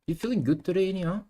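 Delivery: high-pitched mocking tease; teasing. Real, not acted. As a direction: needle him with a singsong lilt. Teasing chat member who shushed.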